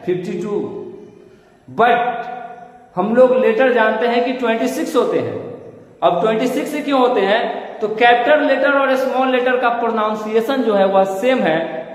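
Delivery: ordinary talk, nothing marked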